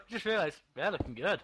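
ordinary delivery

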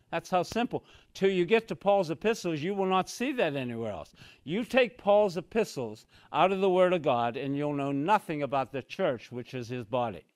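normal talking voice

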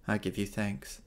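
ordinary delivery

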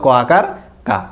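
normal talking voice

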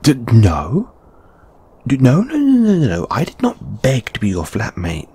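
high, stammering protest; panicked, tongue-tied objection; flustered denial. Acted. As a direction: splutter no over and over, pitch leaping.

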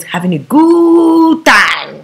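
GOOD stretched long and high in "good time"; drawn-out emphasis. Real, not acted. Stresses the man's carefree fun.

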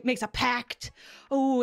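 usual speaking voice

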